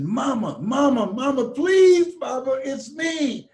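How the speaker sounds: put-on high, rising pleading cries of a terrified boy; desperate child's plea. Retelling himself at five, begging his mother, high and desperate. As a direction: beg your mother to stop, voice climbing.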